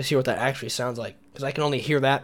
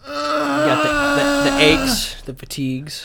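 mock sick moaning; 12 semitones higher, 3 dB louder, much slower than usual. Acting out being sick.

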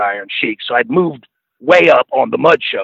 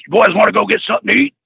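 quick imitation; 7 semitones higher, much faster than usual. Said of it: "And Murdoch goes".

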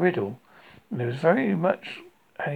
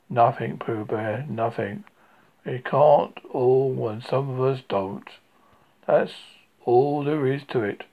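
gloomy donkey. Acted. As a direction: shrug it off in a mournful drone. Eeyore voice, low and mournful.

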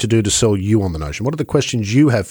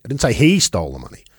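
"he" lifted out, falling away after it; stress on HE; 3 semitones higher than usual. Shown as a demonstration. Host shows how emphasis changes meaning, stress on "he". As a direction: land the emphasis on "he". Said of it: "where you put the emphasis in that sentence".

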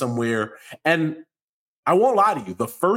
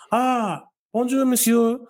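higher, cheery put-on French greeting; lightly hammed-up bonjour; polite tourist greeting; 9 semitones higher than usual.

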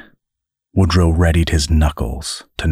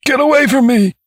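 high-pitched panicked shout; alarmed cry; 4 dB louder than usual. A narrator's reading. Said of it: "cried the man".

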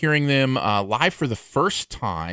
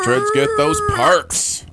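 singsong; 3 dB louder, slower than usual. Acted. Echoing cohost, high slow.

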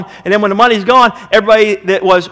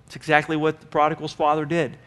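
usual speaking voice

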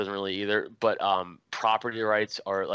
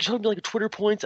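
mock angry jab; 12 semitones higher than usual. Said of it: "his anger went from zero to a hundred".

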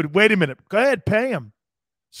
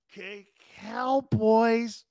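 mocking drawl; 3 semitones higher, much slower than usual. Mocking the rival team.

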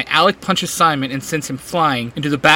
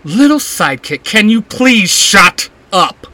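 barking villain; 5 semitones higher, 6 dB louder than usual. A narrator's reading. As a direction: bark down the sidekick with scorn. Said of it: "Jason barks".

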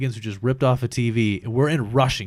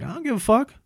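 high-pitched mock defiance; pissed off, dismissive; 13 semitones higher, 3 dB louder than usual. Retelling Haley's answer to the manager, mock pissed-off retort. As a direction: imitate someone brushing it off angrily. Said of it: "So then Haley got pissed".

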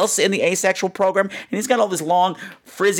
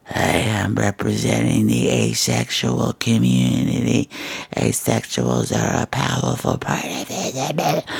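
slow, lower, eerie pamphlet lady voice; creepy voice; 5 semitones lower, slower than usual. Retold or imitated. Strange woman handing out pamphlets, slow creepy voice. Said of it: "She came over with a creepy voice".

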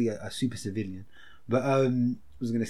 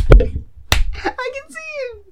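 startled; 10 dB louder, much slower than usual. Real, not acted. Sudden alarm dad walked in.

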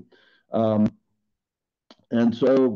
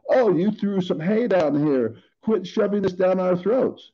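higher-pitched imitation of a protesting listener; mock complaint; 8 semitones higher than usual. Preacher imitates listeners objecting to his message, higher mock complaint. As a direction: play an objector pushing back, pitched up.

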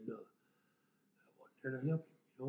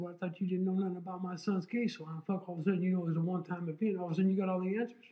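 louder, faster angry comeback; furious retort; 6 semitones higher, 8 dB louder than usual. Retold or imitated. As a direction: hit back, speeding up in anger.

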